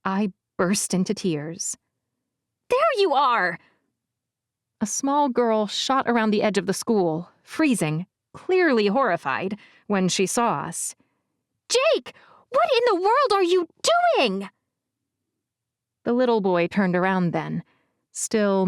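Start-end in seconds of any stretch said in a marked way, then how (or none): 2.7-3.6 put-on high young girl voice; girl calling out. Girl arrives, calls out. 11.7-14.5 put-on high, appalled girl voice; outraged disbelief, pitched high; shocked girl.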